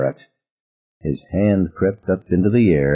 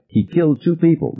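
tense accusation, pitched up; strained, higher voice; tightly; 10 semitones higher than usual. A narrator's reading. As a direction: insist on it tightly. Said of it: "Ted said tightly".

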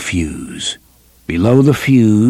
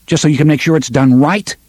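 testy; 6 semitones higher, faster than usual. Acted. Alain snapping back.

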